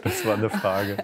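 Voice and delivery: teasing through a laugh; a light amused jab. Real, not acted. Host teasing the guest that it was a question, laughing.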